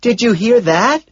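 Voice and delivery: high, loud yelled question; full-volume holler; shouting. A narrator's reading. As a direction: shout it in outrage. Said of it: "Evelyn shouts".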